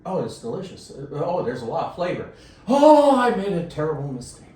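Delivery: from pleased to alarmed shout; rising panic. Retold or imitated. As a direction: start pleased, end in a panicked yell. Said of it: "you're like".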